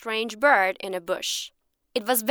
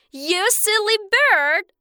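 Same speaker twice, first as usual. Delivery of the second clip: slow, high, louder insult; angry shout; 10 semitones higher, 4 dB louder, slower than usual. Acted. Cheated man shouts at the bird, high and slow. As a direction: shout it in disgust. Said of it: "shouted the man".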